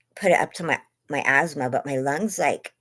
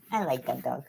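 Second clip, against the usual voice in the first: normal talking voice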